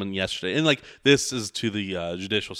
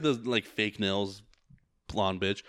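ordinary talk, nothing marked

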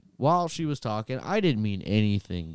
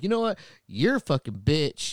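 angry retort; 6 semitones higher, 5 dB louder than usual. Retold or imitated. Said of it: "I was like".

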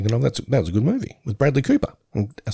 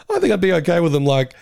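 normal talking voice

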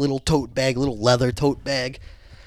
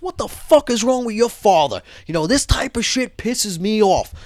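higher-pitched, indignant complaint; retold angry rant; 9 semitones higher than usual. Guard complaining to the kid about his father, acted indignation. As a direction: imitate a fed-up man venting, pitched up.

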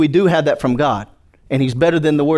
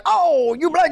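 high, hammed-up offended squeal; mock indignant driver; 12 semitones higher, slower than usual. Preacher mocking an angry driver on the highway, high pitched. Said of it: "so then it's like".

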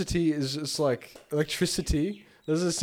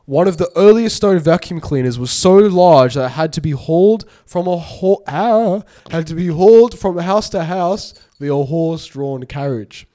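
fact reading; 4 dB louder than usual. Acted. Reads a fact.

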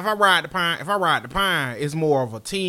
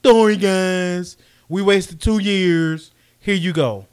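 loud, drawn-out fake sorry; slow, exaggerated apology announced to the crowd; mock apology; 3 semitones higher, 8 dB louder, slower than usual. Team apologising to fans over two wasted years, mock apology. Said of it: "Now you like".

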